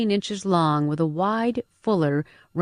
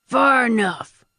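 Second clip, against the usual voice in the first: high, drawn-out bark; barked command; 7 semitones higher, 4 dB louder, slower than usual. A narrator's reading. Older man halting the newcomer, barked. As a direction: bark it out slowly as an order. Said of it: "an older, lean man barked".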